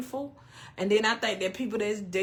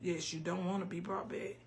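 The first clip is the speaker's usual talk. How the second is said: much softer, murmured read-out; quiet aside; 2 semitones lower, 11 dB quieter than usual. Real, not acted. Streamer answering a viewer's comment, quiet aside. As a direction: drop right down, as if reading to yourself.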